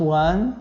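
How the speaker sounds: a clear, careful English one; pronunciation demo. Shown as a demonstration. Teacher modelling One for the class, clear and careful. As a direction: model the word One for the students. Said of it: "o objetivo é só você tá, ah, revisando a pronúncia".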